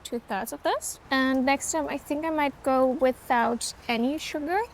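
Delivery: ordinary talk, nothing marked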